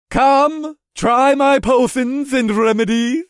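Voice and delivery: high, loud merchant call; cheery vendor hawking wares; friendly shout. Acted. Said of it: "the vendor shouted in a friendly voice".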